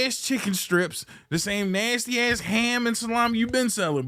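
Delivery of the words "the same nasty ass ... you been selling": ordinary talk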